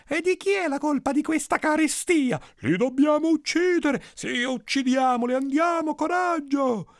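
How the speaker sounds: very high, heated rallying cries; rousing angry mob. Acted. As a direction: stir the village up to kill. Mob rouses itself against wolves. (folla inferocita, grida acute)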